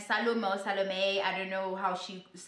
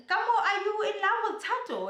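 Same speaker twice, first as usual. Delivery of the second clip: taken-aback question; 11 semitones higher, faster than usual. Retold or imitated. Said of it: "Naledi's like".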